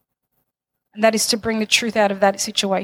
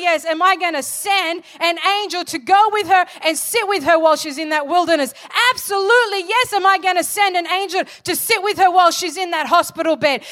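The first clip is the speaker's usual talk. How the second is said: higher, building preacher's cry of absolutely yes; preaching at full force; 6 semitones higher than usual. Acted. Pastor rallying the congregation to full preaching force. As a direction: lift the church with each rising absolutely yes.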